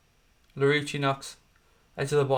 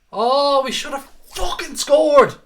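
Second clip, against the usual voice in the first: high, loud, slow furious yell at a miss; furious shout; 12 semitones higher, 8 dB louder, slower than usual. Real, not acted. Fan watching his team miss, furious shout. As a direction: roar out the frustration, dragging it. Missed chance, fan erupts.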